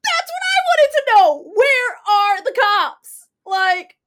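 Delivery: high, loud outraged shout. Real, not acted. Telling a friend about the post office, outraged shout. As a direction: cry out where the cops were.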